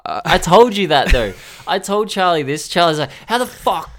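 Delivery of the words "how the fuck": high-pitched, incredulous retelling; exasperated mock complaint. Friend balks at getting through the album, mock exasperation. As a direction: imitate a friend griping in disbelief.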